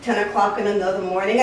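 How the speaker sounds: ordinary delivery, nothing marked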